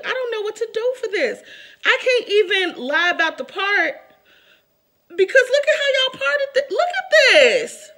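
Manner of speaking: high, loud laughing complaint; laughing in disbelief. Real, not acted. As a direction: laugh through the outrage.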